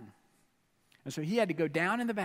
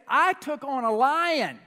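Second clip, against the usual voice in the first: loud, high, slow boast; David boasting to King Saul; proud boast; 6 semitones higher, 6 dB louder, slower than usual. Retold or imitated. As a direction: plant each word proudly. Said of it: "David said".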